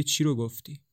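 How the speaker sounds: ordinary delivery, nothing marked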